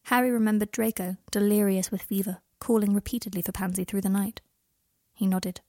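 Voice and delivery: ordinary delivery, nothing marked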